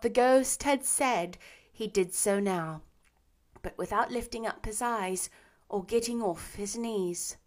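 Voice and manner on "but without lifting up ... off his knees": usual speaking voice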